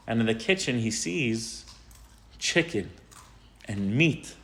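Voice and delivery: ordinary talk, nothing marked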